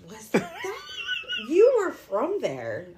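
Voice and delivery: laughing loudly, high and slow. Real, not acted. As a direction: laugh through the words. Laughing at his accent attempt.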